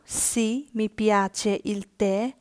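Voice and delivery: very slow, every word of Sì, mi piace laid out clearly; slow teaching read. Shown as a demonstration. Teacher reading the yes answer very slowly for learners. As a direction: drawl Sì, mi piace out for the class. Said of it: "তুমি কি চা পছন্দ করো?".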